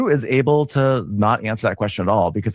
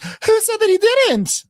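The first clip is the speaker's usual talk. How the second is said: very high, slow falsetto; snappy high defence; mock Kelly; 3 dB louder, slower than usual. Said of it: "Kelly's like".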